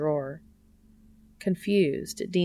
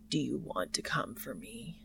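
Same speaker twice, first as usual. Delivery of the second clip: unvoiced, much softer ragged whisper; sex-ravaged whisper; 4 semitones lower, 10 dB quieter than usual. A narrator's reading. Cass asking Dean in bed, ravaged whisper. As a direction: hush it, spent and hungry. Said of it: "in his sex-ravaged voice".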